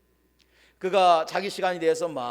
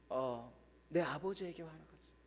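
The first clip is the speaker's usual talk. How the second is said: slow, much softer, hesitant; quietly admitting anger at his father; halting quiet admission; 3 semitones lower, 14 dB quieter than usual. Retold or imitated. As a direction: let it out slowly after a long silence. Hesitant confession after long pause.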